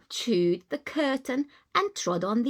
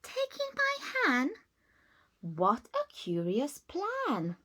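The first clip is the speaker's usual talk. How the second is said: put-on high, incredulous old lady; baffled by the odd advice; puzzled surprise; 5 semitones higher than usual. Old lady doubting the wise man's plan, incredulous.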